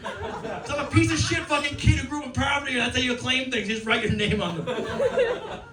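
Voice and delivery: put-on high, gleeful yelling kid; high-pitched boastful child shouting; proud kid shouting. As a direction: crow it out like a proud little vandal. Mocking his kid self, yelling.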